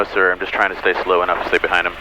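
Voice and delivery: normal talking voice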